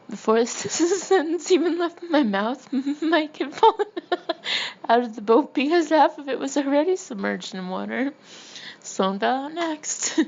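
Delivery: high, loud narration full of laughter; real giggling fit mid-sentence; laughing through the words. Real, not acted. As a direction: fight to read on while cracking up. Cracks up telling boat capsizing.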